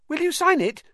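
put-on very high, loud piping boy; eager. A narrator's reading. Colin asks Harry for an autograph, eagerly. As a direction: beg your hero eagerly. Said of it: "said Colin eagerly".

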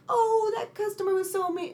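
high, cooing sympathy; a hint of a fussing lady; mock pity for the cashier; sympathetic coworker. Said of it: "she's like".

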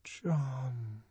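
very soft, drawn-out murmur; murmuring to herself. A narrator's reading. Lenina thinking of him alone, murmuring. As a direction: linger quietly on the name. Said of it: "she murmured to herself".

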